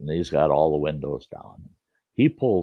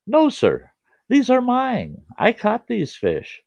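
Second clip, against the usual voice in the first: put-on much higher, polite but firm boy; young boy's voice; 3 dB louder, slower than usual. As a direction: hold your ground like a polite kid. Boy firmly refusing the neighbor.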